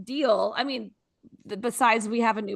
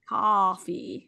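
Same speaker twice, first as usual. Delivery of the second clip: put-on Southern accent; 4 semitones lower than usual. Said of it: "Southern accent".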